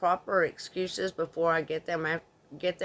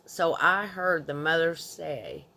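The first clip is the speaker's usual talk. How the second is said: ordinary talk, nothing marked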